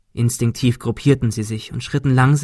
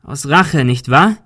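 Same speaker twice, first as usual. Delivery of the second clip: louder accusing call; forceful raised-voice challenge; 3 semitones higher, 6 dB louder, slower than usual. A narrator's reading. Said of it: "rief Ben".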